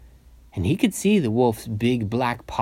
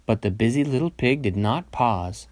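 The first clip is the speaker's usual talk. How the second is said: plain reading voice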